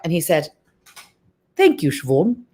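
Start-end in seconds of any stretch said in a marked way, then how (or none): 1.6-2.4 accented impression of a gracious actor; Anthony Hopkins. Film star thanking a starstruck actress, in a put-on accent. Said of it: "you just went into one there".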